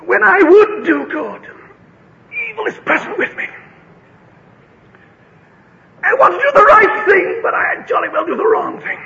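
high, strained outcry; acted inner struggle. Acts out the inner tug of war.

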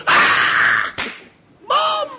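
loud yelp leaping to a very high cry; mock a scared kid shrieking for mother; frightened child. Child fleeing a stranger to mother, comically terrified.

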